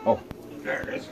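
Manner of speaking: normal talking voice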